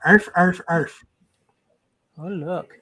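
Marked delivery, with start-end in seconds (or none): 0.0-1.0 playful barking like a dog; dog bark imitation.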